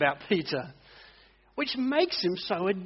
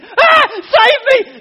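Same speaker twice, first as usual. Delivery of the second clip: high, loud, slow hammed-up cry for help; mock panic cry; 14 dB louder, much slower than usual. Peter sinking in the water, hammed-up panicked cry. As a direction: wail it like a man sinking in the waves. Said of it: "And then panic".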